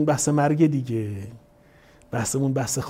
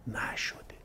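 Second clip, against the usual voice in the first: solemn whisper; 17 dB quieter, slower than usual. Acted. Preacher stressing the mother was never appeased, whispered. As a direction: drop to a bare whisper on the last word.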